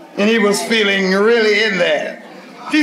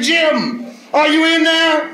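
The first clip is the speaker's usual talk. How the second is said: calling out; 7 semitones higher, slower than usual. Retold or imitated. Calling through restroom door.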